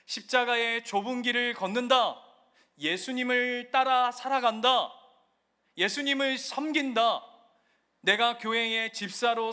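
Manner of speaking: loud, impassioned sermon delivery; preaching at full force. Acted. Pastor preaching the cross to his congregation, impassioned. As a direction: drive each phrase home to the pews.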